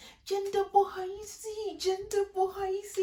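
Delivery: high, pleading apology; over-the-top high sorry; mock grovelling apology. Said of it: "they'll go down on their knees".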